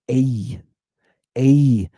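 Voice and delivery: "A" as a long ay gliding to ee; iota heard. Shown as a demonstration. Teacher sounding eta with iota, "A" gliding to ee. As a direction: turn the end of "A" up into an ee. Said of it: "You hear how it turns up in the end?".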